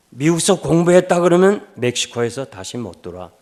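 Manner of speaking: 높고 크게, 천천히 힘주어 다그치듯; 딸들에게 꼭 노라고 하라고 힘주어 이르는 변호사; 단호한 지시. Retold or imitated. 변호사가 딸들에게 강하게 지시함. (변호사가 두 딸에게 단호하게 지시하는 큰 목소리)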